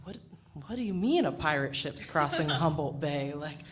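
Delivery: ordinary talk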